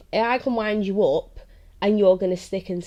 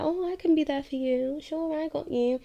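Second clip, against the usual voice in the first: much higher, sing-song imitation of her old self; mock eager promise; 9 semitones higher than usual. Imitating herself promising a new partner she'll be there, high and eager. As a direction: play her past self, overeager to help.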